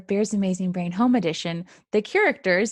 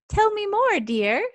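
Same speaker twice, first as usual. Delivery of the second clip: 1 grandma voice; 12 semitones higher than usual. Acted. Grandma answers Mouse.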